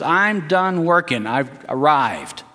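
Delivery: slow, drawn-out mock complacency; self-satisfied mayor. Mayor with feet up on the desk, slow and self-satisfied.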